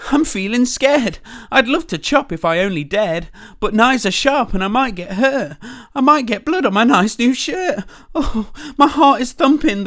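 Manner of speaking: high, loud trembling fright; scared cook. Acted. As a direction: wail it like a wimpy cook. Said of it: "the cook declared".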